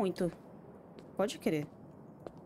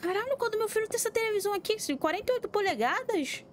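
startled outburst; 10 semitones higher than usual. Real, not acted. Player shocked by the giant TV, startled yelling. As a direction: blurt it out, caught off guard.

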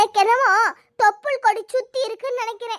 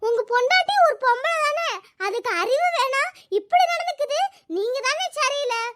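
angry scolding; 2 semitones higher than usual. Acted. Woman blaming a man for letting them go, angry scolding. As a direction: scold him in a raised pitch.

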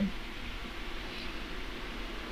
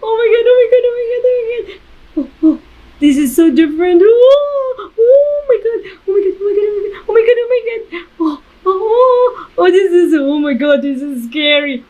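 high panicked crying out; real panic on a ride; terrified; 10 semitones higher, faster than usual. Real, not acted. Terrified on VR roller coaster.

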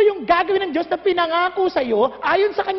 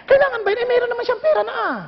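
mocking whine; 8 semitones higher, faster than usual. Mocking friend's excuse, higher.

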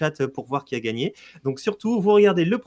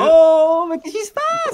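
surprised; 12 semitones higher, 8 dB louder, slower than usual. Real, not acted. Mysterious change appears in park.

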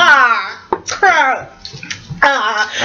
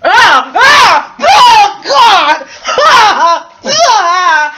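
disgusted screams; 5 semitones higher, 6 dB louder, much slower than usual. Real, not acted. Disgusted by the barf bean.

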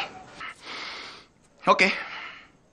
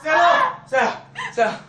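calling out loud; 13 semitones higher, 4 dB louder than usual. Acted. Husband calls after his wife, high loud shout.

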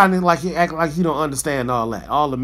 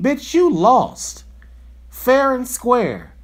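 higher and louder, dragged out slowly; slow, loud put-down; 7 semitones higher, 3 dB louder, much slower than usual. Real, not acted. Ranting vlogger drags each word out to Trump. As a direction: spell it out slowly like a verdict.

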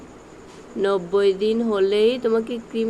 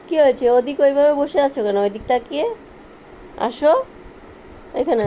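yelling across the room; 5 semitones higher, 5 dB louder than usual. Real, not acted. Calling the pet from afar.

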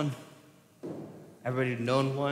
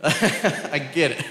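laughing; 7 dB louder, much slower than usual. Real, not acted. Laughs at trick question.

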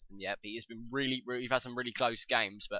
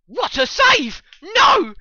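very high, loud cry; real anguished gamer yell; shocked scream; 15 dB louder, much slower than usual. Real, not acted. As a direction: shriek in disbelief at the save. Reacts to a save.

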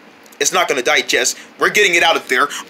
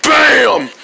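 usual speaking voice

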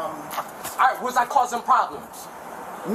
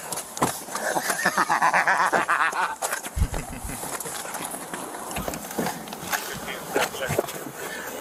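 long mocking laugh; 9 semitones lower, 6 dB quieter than usual. Real, not acted. Arrested man laughing in car.

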